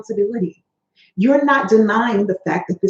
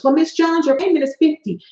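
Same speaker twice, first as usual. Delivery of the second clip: debt collector voice; 6 semitones higher than usual. Acted. Collector voiced in role-play.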